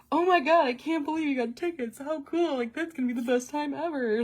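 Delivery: high, gushing mock excitement; demonstrated enthusiasm. Shown as a demonstration. Showing boyfriend how to react to tickets, exaggerated enthusiasm. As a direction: gush as the ideal thrilled reply. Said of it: "I need some enthusiasm here".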